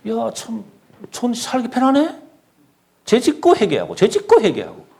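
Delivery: high, pleased mock musing; mock smug self. Preacher mimics his younger self, high and smug. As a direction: lift the voice like a naive believer delighted with a loophole. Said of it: "그러면 내가 어떻게 생각하냐?".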